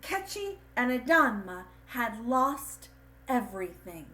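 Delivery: lively storyteller voice; storybook reading. A narrator's reading. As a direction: read the ending like a bedtime story. Closing lines of a read-aloud story.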